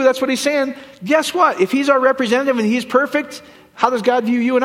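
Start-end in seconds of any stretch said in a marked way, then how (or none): none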